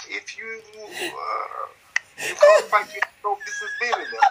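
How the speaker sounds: high strained posh impression, rising; Eubank voice. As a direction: squeak it up like Eubank, ending in a breathy yell. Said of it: "the voice".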